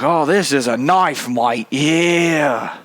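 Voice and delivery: drawn-out mock Australian accent; Crocodile Dundee. Pulling a knife on a mugger, hammed-up Australian accent. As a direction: play a slow, hammed-up Australian tough guy. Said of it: "Crocodile Dundee and be like".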